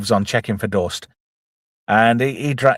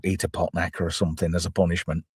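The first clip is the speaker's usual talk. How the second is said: ordinary talk, nothing marked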